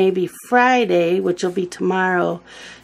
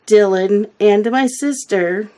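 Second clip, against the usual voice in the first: normal talking voice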